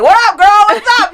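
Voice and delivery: high, loud mock street catcall; imitated catcall. Man hollering at a woman on the street, imitated catcall. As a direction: holler it like a man after a passing girl. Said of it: "like, yelling out the car".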